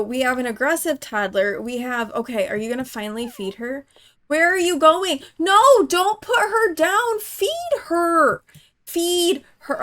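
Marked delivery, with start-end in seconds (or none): 4.3-9.8 high, loud panicked yell; desperate higher shouting; alarmed shout. Real, not acted. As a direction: cry out as the sim walks off. Sim walks away from baby.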